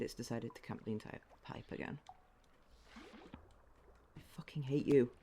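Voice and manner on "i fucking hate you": very slow, drawn-out hatred; slow seething. Real, not acted. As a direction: stretch every word with loathing. Drawn out hate.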